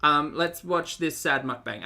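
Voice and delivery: usual speaking voice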